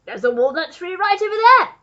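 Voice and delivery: high, loud pointing-out; calling out. A narrator's reading. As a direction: duke calls it across the lawn. Duke points out the tree.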